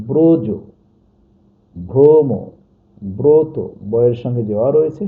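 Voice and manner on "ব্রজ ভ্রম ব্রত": ব্রজ, ভ্রম, ব্রত with a round, stressed o in the first syllable; altered o vowel. Shown as a demonstration. Teacher gives examples of the rule, first vowel pronounced o. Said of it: "অর্থাৎ বিকৃত উচ্চারণ হবে".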